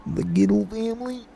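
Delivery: high prank caller voice. Pretend. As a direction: play the oddball caller, high-pitched.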